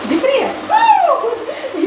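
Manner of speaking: high drawn-out laughing words. Real, not acted. Laughing as she shows viewers the set curd. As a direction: laugh through it, stretched slow and high.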